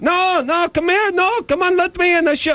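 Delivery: very high-pitched mock Israeli woman; Israeli neighbor accent. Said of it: "sixty-year-old Israeli".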